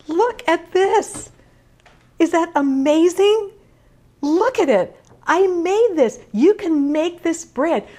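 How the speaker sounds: high, proud and thrilled; excited. Real, not acted. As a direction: show it off, bursting with joy. Showing off finished bread.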